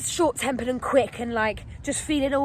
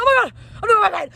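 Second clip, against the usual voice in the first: high, loud, fast exclamation; racing, pitched-up freak-out; re-enacted panic; 8 semitones higher, 7 dB louder, faster than usual. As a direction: gabble it in mock panic.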